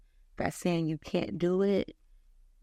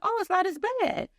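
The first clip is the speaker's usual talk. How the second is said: high, relieved surprise; pleasantly surprised; 13 semitones higher than usual. Retold or imitated. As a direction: sound relieved. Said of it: "and I was like".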